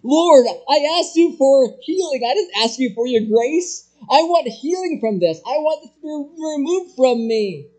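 high, loud pleading complaint; imitating a frustrated Paul begging God; complaining Paul. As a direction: whine like you want your way. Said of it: "Paul could have responded this way".

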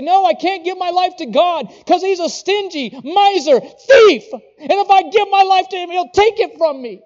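hammed-up shout of a suspicious unbeliever; mock doubter. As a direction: ham up a fearful skeptic calling God a thief. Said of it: "what people think? They say".

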